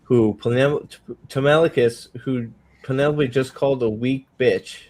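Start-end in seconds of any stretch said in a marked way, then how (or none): none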